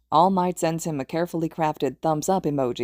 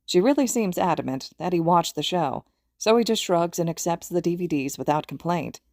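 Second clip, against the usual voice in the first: usual speaking voice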